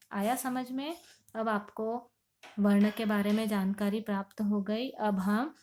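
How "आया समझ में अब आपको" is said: normal talking voice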